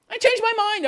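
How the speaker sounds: mock whiny. Mocking an intern who wants pay, whiny high repeat. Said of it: "and then saying".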